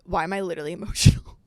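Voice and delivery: usual speaking voice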